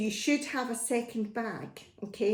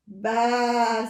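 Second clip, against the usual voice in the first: long, raised baa; bleating sheep; 4 dB louder than usual. Acted. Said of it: "said the sheep".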